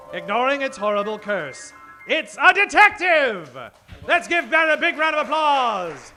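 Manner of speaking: ordinary delivery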